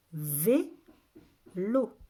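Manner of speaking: two well-separated syllables. Shown as a demonstration. Teacher repeats the word for listening children, syllable by syllable. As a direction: sound it out with a gap between beats.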